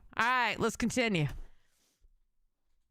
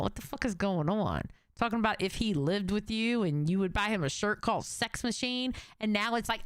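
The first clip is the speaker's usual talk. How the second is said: usual speaking voice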